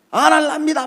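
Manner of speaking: acted defiant outburst; defiant shout. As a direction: shout back at your superior. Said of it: "목사님한테 막 큰 소리 치면서".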